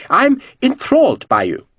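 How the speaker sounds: high, each word crisply pronounced; piping mock flattery; very high-pitched voice. Lord teases the general, high-pitched and precise. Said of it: "very high-pitched voice".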